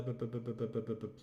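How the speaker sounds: low, soft run of "buh" syllables; absent-minded bu-bu filler. Real, not acted. Host searching the standings page, absent-minded babbling. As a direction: idly babble bu-bu-bu while searching.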